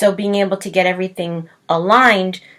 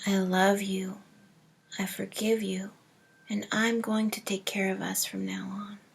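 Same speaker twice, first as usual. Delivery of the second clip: soft, hushed meditation voice with long pauses; hushed, unhurried visualization, fading to near whisper; guided meditation; 9 dB quieter than usual. Acted. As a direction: guide them gently inward, growing quieter and slower. Leads a breathing meditation.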